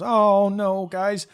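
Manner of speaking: put-on high, loud alarmed boy voice; dismayed kid. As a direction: watch Herb storm out.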